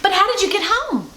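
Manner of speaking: put-on child's question. Child Rosie asks Granny.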